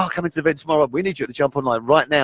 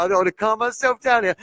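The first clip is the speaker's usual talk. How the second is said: ordinary talk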